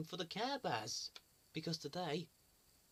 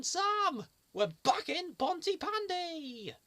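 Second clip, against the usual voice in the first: much higher, slow big announcement; excited; 11 semitones higher, 4 dB louder, slower than usual. Acted. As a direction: proclaim it like a hero.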